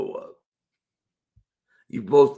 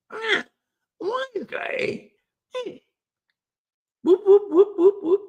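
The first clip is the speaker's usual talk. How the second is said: put-on very high girly chirp with whoops; high hammed-up valley girl. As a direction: cheer it like a giddy teen.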